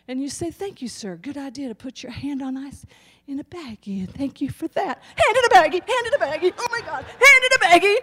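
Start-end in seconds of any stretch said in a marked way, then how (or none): 0.5-5.0 professional. Acted. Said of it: "you connect with your professional self". 5.2-8.0 high, loud, laughing disbelief; mock freakout. Nurse losing it over a hand in a baggie.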